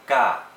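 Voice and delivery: Ga short, staccato and loud; fourth tone. Shown as a demonstration. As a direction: punch out Ga as if yelling it. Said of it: "sounds kinda like you're yelling it".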